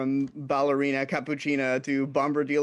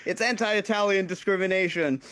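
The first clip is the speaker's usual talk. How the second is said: lightly voiced protesting TV mobster, pitched higher; a light take on an indignant character, higher than usual; Silvio from The Sopranos; 5 semitones higher than usual. Guest quotes a Sopranos bit to the host, lightly mock-indignant. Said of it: "where Silvio hears ... and he says".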